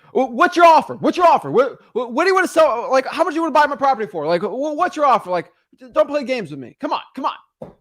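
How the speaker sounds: high, loud, pushy demands; hammed-up rapid-fire directness; fast, direct New Yorker. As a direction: act an impatient New York seller pressing for an offer. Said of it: "they speak sometimes very fast and very direct".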